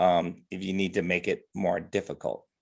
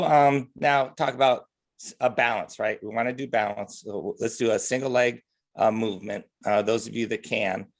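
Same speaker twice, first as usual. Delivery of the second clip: normal talking voice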